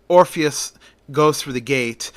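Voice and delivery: usual speaking voice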